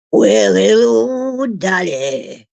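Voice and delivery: slow, drawn-out Australian greeting; Australian accent. Pretend. Crooning to the dog Dolly in a slow Australian accent. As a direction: stretch the Aussie hello out slowly. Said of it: "I guess I sound a bit Aussie there".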